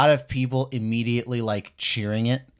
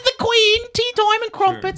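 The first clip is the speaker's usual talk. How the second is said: much higher, squeaky posh English parody; mock posh British accent; 5 dB louder than usual. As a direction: ham up a tea-and-crumpets Brit. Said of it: "into the British side of things".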